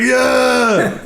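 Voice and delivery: high mock shout of the crowd; crowd cheer. Host imitating the crowd cheering, mock shout. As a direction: cheer like the whole arena. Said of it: "everybody's like".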